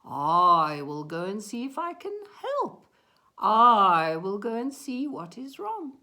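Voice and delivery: loud mooing swell on opening words; helpful cow. A narrator's reading. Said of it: "So the one cow said".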